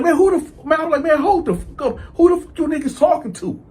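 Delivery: acting out a high-pitched angry outburst; irritated, elevated voice. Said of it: "my voice may have elevated 'cause I'm irritated".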